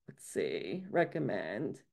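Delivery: low, soft aside while searching; talking to oneself. Real, not acted. Presenter hunting for a menu item, talking to oneself. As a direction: drop right down, as if thinking aloud.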